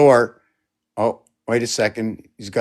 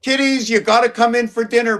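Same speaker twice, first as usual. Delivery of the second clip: mother calling; 9 semitones higher than usual. Pretend. Mother calling kitties to dinner.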